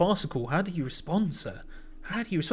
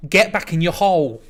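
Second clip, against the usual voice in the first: slow, loud mock shout; drawn-out mock yell at the manager; 7 dB louder, slower than usual. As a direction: bark it slowly at David. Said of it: "That's what I said".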